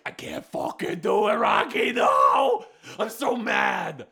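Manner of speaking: rising to a shout, then a slow growl; Apollo raging. Retold or imitated. Apollo yells at Rocky about not letting go. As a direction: yell it out in frustration at Rocky. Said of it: "Apollo's like".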